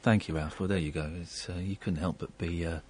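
quietly moved, holding back tears; soft and a little choked after the sad call. Real, not acted. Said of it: "I'm welling up a little bit".

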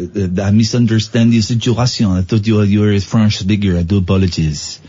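put-on apologetic comic Frenchman, thick accent; hammed-up French-accented English. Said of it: "my French accent".

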